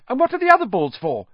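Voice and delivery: put-on a kid hungry for every detail; eager boy question. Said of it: "Colin asked".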